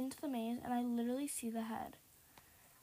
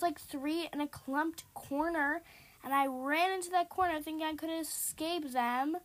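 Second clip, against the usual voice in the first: normal talking voice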